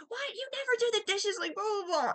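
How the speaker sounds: very high mock nagging yell; retold screaming. Roommate screaming at him about the dishes, high mock yell. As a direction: imitate a roommate screeching, tailing into blahs. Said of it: "just screaming at him".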